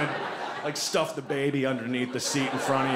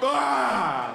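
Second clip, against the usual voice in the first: mock wail; 9 semitones higher, 5 dB louder than usual. Mock wail after door shuts.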